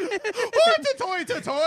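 high, slow hammed-up Irish accent; a silly stage-Irish Oi, drawn out high; mock Irish accent. Joking Irish accent answering friends mocking his impression. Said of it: "Dude, you sound Irish".

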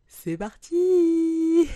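high, loud, drawn-out cheer; excited. Real, not acted. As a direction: kick it off with a big shout. Launching the episode.

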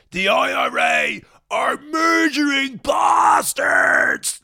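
slow, high, furious yell; angry shout. Retold or imitated. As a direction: bellow it right in his face. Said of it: "shouted in my face".

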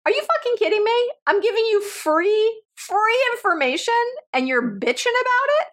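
high-pitched mock indignation; incredulous retort. Host answering complaining listeners, incredulous. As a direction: fire back in high-pitched disbelief.

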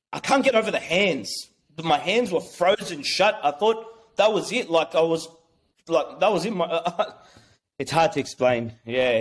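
usual speaking voice

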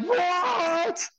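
very high, drawn-out disbelief; long retold squeal of surprise; astonished. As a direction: act out amazement, stretching it high. Acted reaction to Australia invitation.

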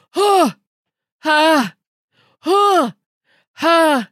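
short, loud, high grunts, one by one; imitated tennis grunts. Mimicking tennis players to a fellow guest, loud breathy grunts. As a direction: grunt like a player hitting each ball.

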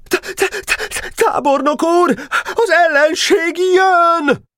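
high, stammering panic, slow and halting; frightened stammer. A narrator's reading. Sentry on the fence stammers to the general, terrified. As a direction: stutter out the alarm, terrified. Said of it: "s ijedten dadogta".

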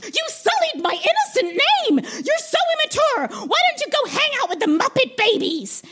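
very high, huffy diva voice; indignant Miss Piggy. Acted. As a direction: scold her like a wounded diva. Miss Piggy bursts forth.

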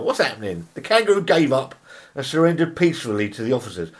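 usual speaking voice

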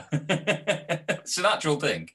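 slow, laughing through words; can't keep a straight face. Real, not acted. Laughing at the story.

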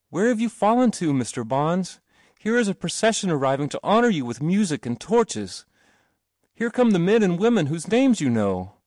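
high, eager proclamation; excited calling. A narrator's reading. Boy announcing the procession to his companion, excited calling. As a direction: call out the marvels, pitch lifted.

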